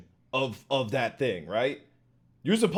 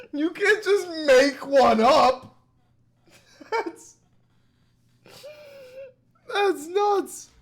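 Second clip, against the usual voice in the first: very high, drawn-out disbelief; incredulous; 14 semitones higher, 4 dB louder, slower than usual. Real, not acted. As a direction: protest in a squeaky, slow, amused outburst. Reacting to a clip's made-up accent.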